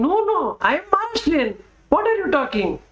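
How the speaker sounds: very high, indignant protest; acted-out outraged retort; worked up. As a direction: insist heatedly, voice pitched right up. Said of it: "I get so much worked up".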